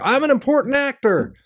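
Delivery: high, pompous self-important actor; Christopher Reeve impression. Comic mocks an actor jumping the cure queue, high pompous voice. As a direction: puff up like a vain star. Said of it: "he's gonna be mowing them down".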